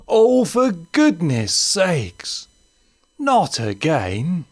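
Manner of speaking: high, slow, drawn-out sigh of a line; weary put-upon grown-up, very slow; exasperated Martin. Acted. Martin fetching the ball yet again, slow and exasperated.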